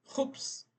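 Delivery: Khubz whispered high with a rasping kh; Arabic khubz. Shown as a demonstration. Teacher showing students the Arabic word, whispered throaty kh. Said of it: "é conhecido no mundo árabe todo como khubz".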